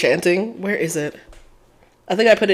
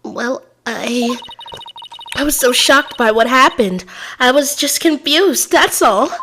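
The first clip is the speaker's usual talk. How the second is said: lightly voiced nervous witness, higher; flustered witness; 6 semitones higher than usual. Gamer voicing the shaken witness, a touch higher.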